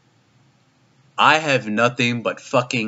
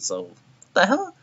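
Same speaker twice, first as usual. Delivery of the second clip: high, baffled the hell; a squeaky, stunned question; incredulous; 10 semitones higher than usual. Real, not acted. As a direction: voice jumps up in disbelief. Exasperated disbelief at bad dialogue.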